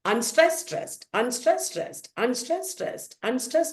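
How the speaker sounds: rhythmic "unstressed, stressed" chant, each pair rising; iambic rhythm. Shown as a demonstration. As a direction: tick off each "unstressed, stressed" foot as a beat. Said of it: "like that five times".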